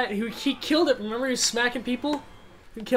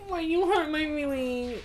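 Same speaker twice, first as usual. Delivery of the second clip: put-on childish baby voice; 8 semitones higher, 5 dB louder than usual. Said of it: "my childish baby voice".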